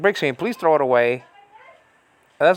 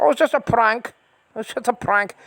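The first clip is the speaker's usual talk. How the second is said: higher, mocking American accent; a raised-pitch American accent, teasing; 8 semitones higher than usual. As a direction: parody an American saying it's a prank. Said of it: "they sound like an American person".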